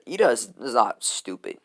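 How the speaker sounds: usual speaking voice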